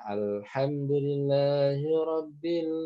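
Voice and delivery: Alhamdulillahi Rabbil recited slowly with a light, unbounced lam; slow model recitation of Alhamdulillahi Rabbil; correct thin lam. Shown as a demonstration. Teacher models a correct recitation slowly for students.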